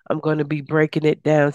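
ordinary talk

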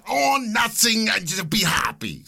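loud, high mock foreign accent; Klaus Schwab. Host redoing his Schwab line, loud mock accent. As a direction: belt out a hammed-up European accent. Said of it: "That's Klaus Schwab".

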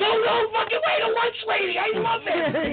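high-pitched imitation of an excited fan; mock starstruck squealing; freaking out. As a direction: imitate someone losing it with delight, voice way up. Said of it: "she starts freaking out".

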